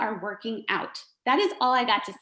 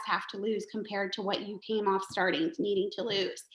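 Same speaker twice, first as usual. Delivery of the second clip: usual speaking voice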